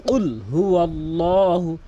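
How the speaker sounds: কুল হুয়াল্লাহু drawn out slowly in chant; slow Quran recitation. Shown as a demonstration. Teacher reciting for students to repeat, slow chant. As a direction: recite it slowly, melodic tilawat. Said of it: "আমার সাথে বারবার practice করবেন".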